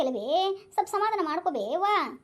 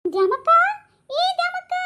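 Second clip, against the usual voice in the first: high call across the house; 12 semitones higher, 7 dB louder than usual. Acted. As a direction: call her name from afar. Calling out to Dyamakka. (�ದೂರದಿಂದ ಕೂಗುವುದು)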